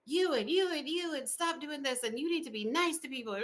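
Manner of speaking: high, mock-scolding parent pointing at everyone. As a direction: play the nagging parent wagging a finger. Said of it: "scold everybody like I'm their parent".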